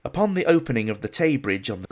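ordinary talk, nothing marked

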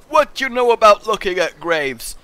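loud sassy demand; mock challenge. Gamer teasing Clementine about graves, sassy. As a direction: taunt her playfully.

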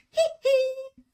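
very high falsetto squeal. Retold or imitated. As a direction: imitate the pop star's signature yelp, high and loud. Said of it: "a dude dressed as [name]".

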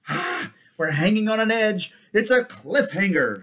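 high, loud hammed-up excitement; playful suspense. Real, not acted. Reader closing the chapter for listeners, playfully excited. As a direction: exclaim it with mock drama.

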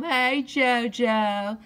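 drawn-out loud call to the cat. Real, not acted. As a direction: stretch it out and call him home. Calling the cat.

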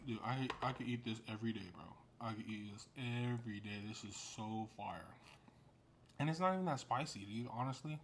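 speaking between slurps of food; talking while chewing. Real, not acted. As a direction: mouth full of noodles. Seen 0: slurps noodles between phrases, mouth full while speaking.

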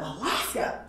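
high, slow incredulous question; surprised disbelief. Retold or imitated. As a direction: stretch it out in disbelief. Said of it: "he said".

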